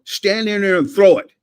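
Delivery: loud, high sideline instruction, retold; retold coach's order. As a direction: call it out firmly to a player. Coach retells ordering his quarterback.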